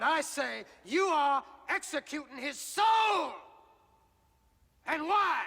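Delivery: high, loud shout of outrage; fiery courtroom-style denunciation; thundering accusation. Acted. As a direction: build to a furious roar. Climax of angry accusation.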